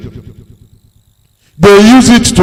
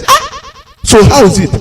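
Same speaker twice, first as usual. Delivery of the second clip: surprised. Retold or imitated. Surprised question.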